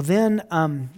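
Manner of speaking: ordinary talk, nothing marked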